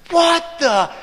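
high, loud, indignant yell; outraged cut-off exclamation; mock cussing outburst. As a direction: imitate a man bursting into curses. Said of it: "he starts cussing".

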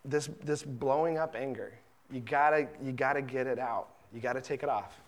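hushed, gentle fatherly rebuke; soft tender correction. Retold or imitated. God gently telling his beloved children to let go of rage, softly. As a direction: coax them gently to take it off. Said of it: "He says".

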